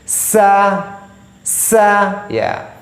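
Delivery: "sa" said light and thin, not "so"; thin sa, the way a Quran teacher models it. Shown as a demonstration. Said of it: "maka sin tipis".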